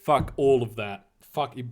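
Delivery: ordinary delivery, nothing marked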